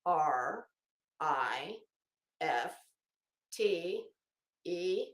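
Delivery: each letter slow and separate, with pauses; mock chat typing, letter by letter; spelled out letter by letter. Shown as a demonstration. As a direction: spell it out one letter at a time. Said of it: "type one letter at a time".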